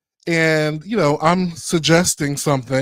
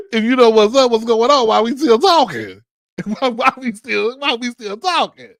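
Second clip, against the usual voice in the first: higher, laughing, teasing exasperation; 7 semitones higher than usual. Retold or imitated. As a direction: replay the line with amused exasperation. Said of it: "Like, like, what?".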